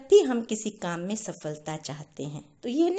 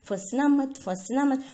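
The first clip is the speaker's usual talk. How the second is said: raised, louder scolding; 3 semitones higher, 4 dB louder than usual. Acted. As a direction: tell the parrots off sharply. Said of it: "डांट लगाई".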